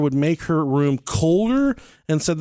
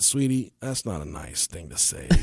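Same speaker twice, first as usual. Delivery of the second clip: much softer, lower sweetie; gentle correction; 7 semitones lower, 8 dB quieter than usual. Retold or imitated. Father gently correcting his daughter, soft and low. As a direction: soothe her while correcting. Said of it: "I said".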